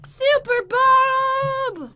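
high, loud, long-drawn announcement; hammed-up intro. Player announcing Super Bob's arrival, hammed-up intro. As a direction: stretch the name like a big entrance. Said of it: "you gave him a cool intro".

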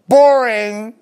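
high, loud, drawn-out taunt; loud mock taunt. Taunting the staff who were acting in unison. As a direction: sing out the word to mock them. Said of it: "And I said that".

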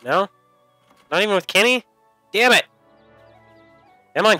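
usual speaking voice

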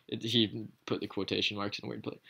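usual speaking voice

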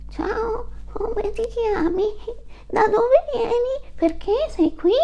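put-on very high, slow childlike questions; little kid quizzing a stranger; curious little goat. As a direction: ask like a wide-eyed small kid.

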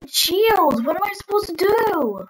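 high, urgent yell for help; panicked shout. Real, not acted.

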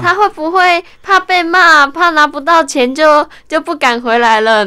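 imitating the boss fretting she ran off; worried boss. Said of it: "然后陶哥就说".

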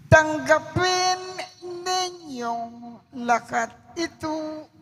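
ordinary talk, nothing marked

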